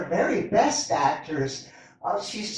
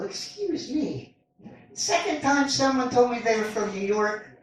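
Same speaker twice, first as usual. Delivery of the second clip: ordinary delivery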